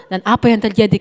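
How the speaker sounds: usual speaking voice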